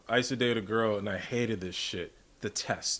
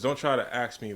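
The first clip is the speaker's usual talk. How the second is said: normal talking voice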